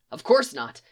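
usual speaking voice

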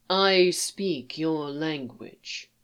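put-on slow, carefully accented Basic; simplified speech in a foreign accent; alien accent. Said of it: "accented and simplified".

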